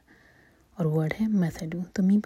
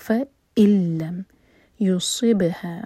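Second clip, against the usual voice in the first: voiced, very slow tajweed recitation; slow Quran recitation; 5 dB louder, slower than usual. Shown as a demonstration. Teacher recites the verse to show the rules, slowly. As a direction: draw each syllable out carefully. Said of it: "Hamza پر cut off sound".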